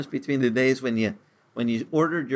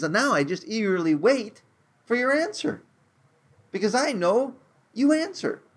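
eager hope; 9 semitones higher than usual. Acted. David eagerly awaiting God's answer.

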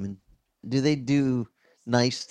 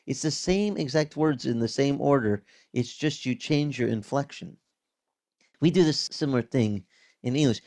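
ordinary talk, nothing marked

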